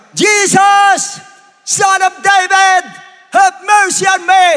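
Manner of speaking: high, loud, drawn-out shout; crying out. Acted. Bartimeus at the back makes the Lord hear, shouting. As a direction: holler it above a whole crowd. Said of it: "Sumigaw siya".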